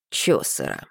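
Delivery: deadpan male echo; dry deep repeat. Acted. William repeats dryly. (низко и сухо)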